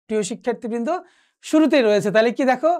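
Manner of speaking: usual speaking voice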